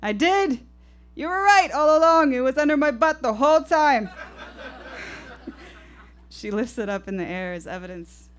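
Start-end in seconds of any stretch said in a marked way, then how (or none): 0.0-4.1 put-on high, loud, triumphant old woman; gleeful grandmother. Grandmother proud to find remote. 4.1-6.0 put-on breathless high giggle of an old woman; childlike giggle. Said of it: "the laugh of an eighty-six-year-old child".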